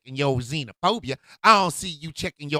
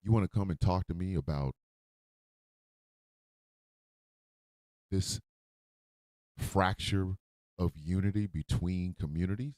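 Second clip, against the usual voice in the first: hurt and quiet; 9 semitones lower, 8 dB quieter than usual. Real, not acted. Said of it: "I'm hurt".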